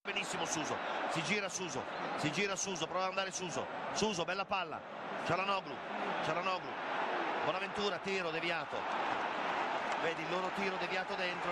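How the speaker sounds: rising excited play-by-play; breathless italian goal commentary. Acted. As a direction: call the action fast and eager. Live play-by-play build.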